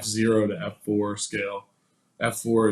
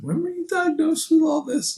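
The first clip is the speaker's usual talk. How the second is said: much higher, mock astonished tech voice; 4 dB louder than usual. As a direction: squeak it out like a baffled technician. Imitating the astonished MRI tech.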